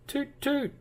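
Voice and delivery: high squeaky toot toot like a horn; bus horn toot. Acted. Reader voicing the bus horn, high toot. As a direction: honk it like a toy bus.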